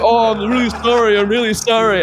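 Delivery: high, insistent mock apology; imitated pleading apology. Roommate apologising for the broken door, imitated pleading. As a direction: plead for forgiveness, acting it out.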